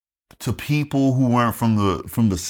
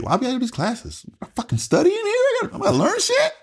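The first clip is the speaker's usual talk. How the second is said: very high, squeaky complaint; comic protest. Retold or imitated. Recruit complaining about unexpected classes and studying, comic disbelief.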